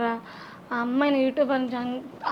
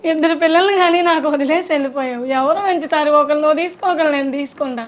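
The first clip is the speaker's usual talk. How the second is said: loud, smiling take on a sad lament; acted cheerfulness; 5 dB louder than usual. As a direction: say the sad lines with a put-on smile. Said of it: "same dialogue నవ్వుతూ". (నవ్వుతున్నట్టు గట్టిగా నటిస్తూ)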